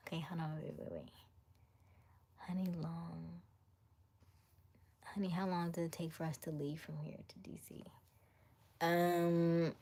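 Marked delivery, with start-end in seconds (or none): 0.1-7.8 hushed whisper off to the side; whispered aside. Real, not acted. Whispering to honey about the drive time. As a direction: quietly ask honey without the mic hearing.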